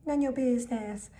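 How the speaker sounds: high, sing-song tease; teasing brush-off. Retold or imitated. Two friends teasing Dana, sing-song brush-off. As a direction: tease her playfully.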